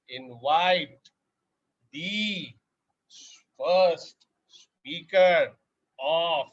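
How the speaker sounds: very slow, drawn-out words; slow motion. Shown as a demonstration. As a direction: stretch every word out slowly. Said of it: "Are you surprised why I'm talking so slow?".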